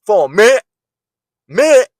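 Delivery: "minute" squashed into one syllable, high and loud; mock "minute" as a slurred "mit"; mocked mispronunciation. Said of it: "you don't know how to say minute".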